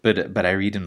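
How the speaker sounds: ordinary talk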